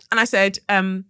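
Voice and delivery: ordinary delivery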